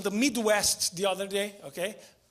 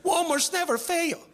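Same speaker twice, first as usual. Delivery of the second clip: much higher, laughing disbelief; mock disbelief; 9 semitones higher, slower than usual. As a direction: act shocked that a Walmart could fail. Mock astonishment at failed Walmart.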